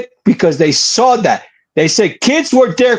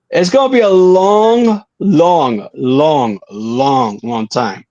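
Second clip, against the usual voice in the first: stretching the long long long time down to a hush; dragged-out repetition; 3 semitones lower, slower than usual. Real, not acted. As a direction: let every long fall away into a mutter.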